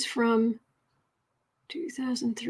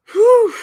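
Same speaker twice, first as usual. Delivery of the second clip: relieved whew; 11 semitones higher, 11 dB louder than usual. Real, not acted. Relief after tech trouble.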